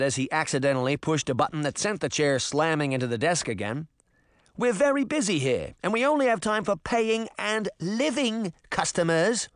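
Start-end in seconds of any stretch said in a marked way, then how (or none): 4.6-9.5 put-on Tim, English boy. Tim's English voice.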